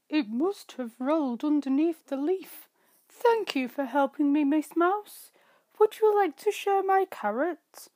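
a rabbit beaming with thanks to his friend; happy rabbit. Acted. Rabbit happily thanks the mouse.

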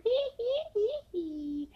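squeaky derisive hee-hee laughter; mocking high cackle. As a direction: cackle at them like a taunting kid. Mocking cackle after parody.

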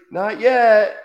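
high, loud mock call; hammed-up high "not yet"; mimicked kid's line. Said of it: "Just like".

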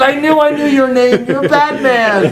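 excited laughing recognition. Retold or imitated. Architect excitedly recognizes Batman.

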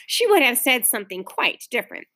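usual speaking voice

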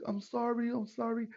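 high-pitched repeated apology; lightly voiced apologetic stranger; imitated apologetic driver. Retold apology of the truck driver.

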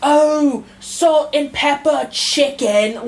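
high, slow imitation of a British foodie; a light British put-on; mock British accent. Aping British food TikTok in a high, slow British accent. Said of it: "And it's all".

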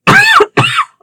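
sharp dry cough; coughing on dust. Real, not acted. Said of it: "I inhaled a bunch of dust".

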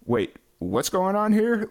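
high and slow, amazed double take; mock surprise. Host recalling reading the UK festival bill, slow amazed surprise. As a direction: stretch it out as if you can't believe the festival lineup. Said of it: "I was like".

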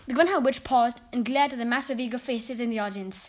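ordinary delivery, nothing marked

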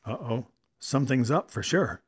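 ordinary delivery, nothing marked

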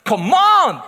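a loud, shouted "Come on!"; doing his own quirk. Retold or imitated. [name] shows colleagues his quirk, loud and hammy. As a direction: belt the catchphrase out like a running gag. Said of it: "hättest du so gemacht".

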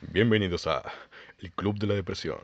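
low, weighty announcer intro; deep voice. Acted. Co-host asked to welcome listeners to the club, deep voice. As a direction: drop the voice down for a podcast welcome. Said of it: "tú tienes la voz gruesa".

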